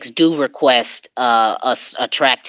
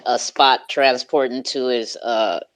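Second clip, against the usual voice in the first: normal talking voice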